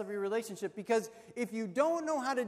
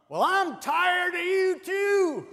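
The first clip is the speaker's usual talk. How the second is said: high, loud, drawn-out holler; hammed-up old-couple bellow; deaf husband shouting back; 10 semitones higher, 11 dB louder, slower than usual. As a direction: yell it back, slow and indignant. Deaf husband yells back mistaken reply.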